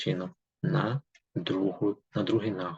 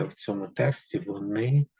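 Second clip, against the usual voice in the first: ordinary talk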